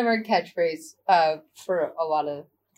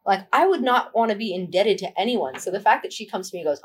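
normal talking voice

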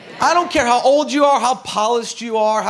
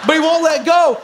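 excited shout; 6 semitones higher, 6 dB louder than usual. Real, not acted. Excited retelling of the fight.

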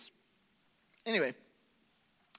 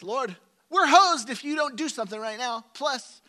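much louder, higher, half-joking cry to the Lord; desperate plea; 10 semitones higher, 13 dB louder than usual. Retold or imitated. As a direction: cry out like you're out of options. Desperate prayer voiced.

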